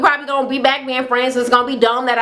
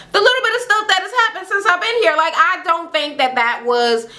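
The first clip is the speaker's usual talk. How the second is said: ordinary talk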